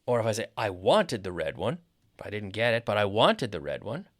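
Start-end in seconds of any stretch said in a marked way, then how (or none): 0.5-1.8 WANTED punched, rising tail; WANTED emphasized, ending on a rise; stress on WANTED. Shown as a demonstration. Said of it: "we can stress different words". 3.0-4.1 WANTED punched, with a rising end; WANTED emphasized as if something is unfinished; stress on WANTED. Shown as a demonstration. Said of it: "we can stress different words".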